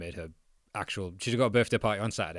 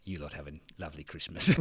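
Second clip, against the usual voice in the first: touchline coach impression; 6 semitones lower, faster than usual. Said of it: "how he talks when he's yelling at people on the touchline".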